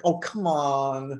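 slow, drawn-out weary "oh, come on"; exasperated. Retold or imitated. Guest losing patience with the piano tuner, slow exasperation. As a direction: stretch it out, fed up and disbelieving. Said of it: "I was like".

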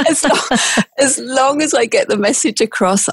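high, real laughing voice; through laughter. Real, not acted. Podcaster laughing off her own mistakes in Italian, through laughter. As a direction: let a laugh push the words up high.

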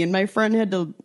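usual speaking voice

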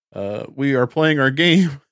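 ordinary talk, nothing marked

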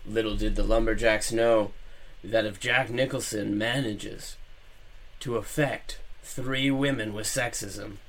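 low, hushed doom narration; ominous narration. A narrator's reading. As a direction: narrate like a dark trailer. Narrates ominous story turn.

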